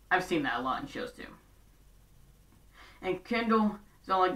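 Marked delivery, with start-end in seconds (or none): none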